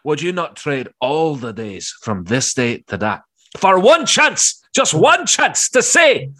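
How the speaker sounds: rally the troops, each word stretched; hammed-up William Wallace battle cry; rousing Braveheart speech. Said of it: "That was perfect".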